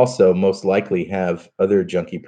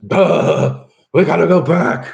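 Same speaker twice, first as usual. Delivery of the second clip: put-on higher, louder grumbling old professor voice; grumpy wiry professor; 10 semitones higher, 5 dB louder, slower than usual. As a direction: play a cranky old professor who wants to turn back. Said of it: "the wiry professor look".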